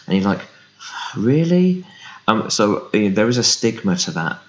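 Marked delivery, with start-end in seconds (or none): 1.2-1.9 high, louder mock disbelief "Really?"; high incredulous squeak. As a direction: sound genuinely taken aback. Mock disbelief at vegan ranking.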